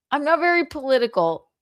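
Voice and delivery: ordinary talk, nothing marked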